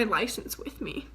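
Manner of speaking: hushed whisper of dread. Real, not acted. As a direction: whisper the realization like a confession. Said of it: "whisper heard".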